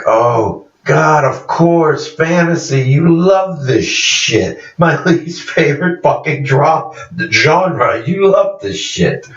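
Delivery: high, slow whiny disgust; exasperated. Retold or imitated. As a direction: groan it out, fed up with fantasy. Character mocking fantasy genre.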